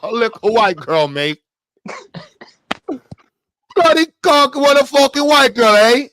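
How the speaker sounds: loud, high hammed-up Irish accent; bad Irish accent. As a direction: yell it like a clumsy fake Irishman. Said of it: "I don't even know how to do their accent".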